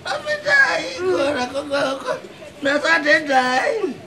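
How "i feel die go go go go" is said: dying moan. Acted. Moaning he is dying.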